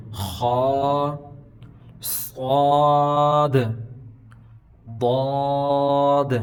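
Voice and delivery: خا، صاد، ضاد full-mouthed with long rounded vowels; slow, sustained, heavy recitation of خا، صاد، ضاد; thick heavy letters. Shown as a demonstration. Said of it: "ہر حالت میں موٹا کر کے پڑھتے ہیں".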